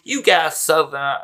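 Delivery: put-on high, loud southern accent, cut off laughing. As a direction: try the southern line again. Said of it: "he has a southern accent like that".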